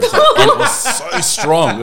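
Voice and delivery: high, loud, through real laughter; cracking up on the emphatic words; laughing. Real, not acted. Comparing it to a cat marking territory, laughing.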